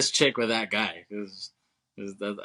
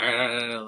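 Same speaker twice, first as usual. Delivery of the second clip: mock "argh" of pain; drawn-out comic groan; imitated pained groan. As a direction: act out a bitten man's cry. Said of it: "dog start chewing his hand".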